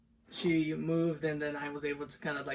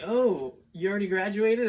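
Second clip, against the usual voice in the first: loud, raised mock surprise; retold surprise; 5 semitones higher, 9 dB louder than usual. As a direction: act out the surprised question, louder and higher. Retold meeting with older guys.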